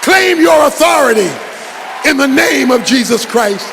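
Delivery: forceful preaching. Acted. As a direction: preach it hard to the congregation.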